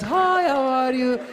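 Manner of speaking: high, slow, drawn-out greeting; exaggerated friendly Persian. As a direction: sing out a sugary, stretched hello. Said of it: "We talk like this".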